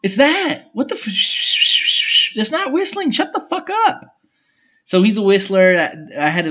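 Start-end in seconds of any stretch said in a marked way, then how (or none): 0.0-4.0 high mock complaint. Retold complaint to brother.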